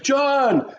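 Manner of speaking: high, loud imitated call; imitating a thrilled shout of a name; excited greeting. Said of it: "very excited".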